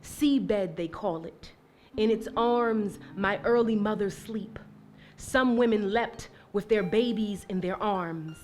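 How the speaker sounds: hushed, grave spoken-word reading; poem recitation. Acted. Poet reciting about ancestors at sea, hushed and grave.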